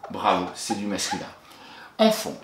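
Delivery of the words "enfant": "Enfant" called out high and clear; bright dictation. Shown as a demonstration. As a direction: announce the new word with lift. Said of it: "dictée du mot suivant". (maîtresse qui annonce le mot, voix haute et claire)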